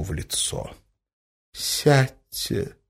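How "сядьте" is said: higher, drawn-out, sorrowful request; sad. A narrator's reading. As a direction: invite him sadly to sit. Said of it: "грустно проговорила она". (грустно, протяжно)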